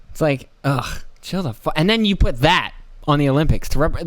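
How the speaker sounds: ordinary talk, nothing marked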